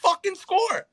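imitating a fed-up quarterback, rising; climbing imitation of angry disbelief; quoting frustration. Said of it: "he's like".

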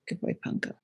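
soft unvoiced whisper; whispered praise. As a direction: breathe it like a whisper.

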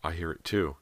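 usual speaking voice

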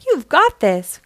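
high loud cheer; root for him from the sidelines; cheering cry. A narrator's reading. A falcon friend cheers Blaze into the lead, loud and high. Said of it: "cried another".

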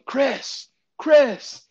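high, loud call of a name across the field; retold yelling. Partner yelling across the diamond to the catcher, high loud call. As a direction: shout his name to get his attention from afar. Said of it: "I just hear you yelling".

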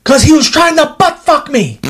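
high-pitched imitated cry of outrage; retold shout. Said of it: "He shouts".

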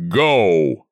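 loud single-word command; commanding shout. A narrator's reading. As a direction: blast it out with all his power. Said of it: "power of his body concentrated in a word".

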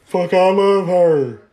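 put-on slow, loud, slurring drunk friend. Drunk friend woken on swing.

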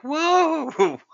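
high-pitched drawn-out whoa; long mock-amazed whoa; imitated whoa. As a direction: send up an Owen Wilson-style whoa. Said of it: "It was like".

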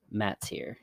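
flat, emotionless, quiet statement; deadpan. A narrator's reading. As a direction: say it with no feeling at all. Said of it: "in the most deadpan voice".

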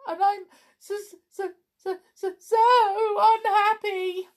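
high, wailing sob on so unhappy; bursting into tears. Acted. Clown telling his friends he can't wind himself up, crying. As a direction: let it break into crying. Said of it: "He burst into tears".

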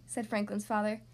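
usual speaking voice

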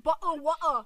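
mock British glottal stops; British accent. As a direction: drop the T's, mock-British. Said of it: "They're saying".

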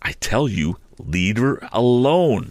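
slow, weighted leave her alone; firm warning. A narrator's reading. Joe tells Orlick to leave her alone, slow and firm. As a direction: lay it down slowly and firmly. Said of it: "said Joe".

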